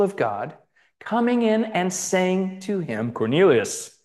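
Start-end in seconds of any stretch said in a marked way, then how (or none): 3.1-4.0 low, booming call from an angel; deep angel voice. Acted. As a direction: call the name in a deep heavenly voice. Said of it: "angel's voice always has to go deep".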